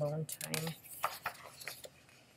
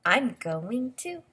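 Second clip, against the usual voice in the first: lilting storybook read-aloud; expressive picture-book voice; reading to children; 10 dB louder than usual. Acted. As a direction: read the book's lines to a little listener.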